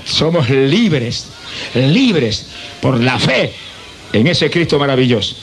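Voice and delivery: slow, low, tender declaration. Real, not acted. Soft slow worship. (lento y tierno, en adoración)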